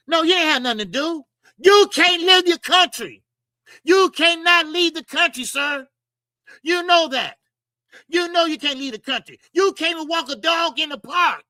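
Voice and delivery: loud, high, emphatic calling-out; indignant rebuke. Retold or imitated. Commentator calls out a boxer's excuse for staying home, loudly indignant.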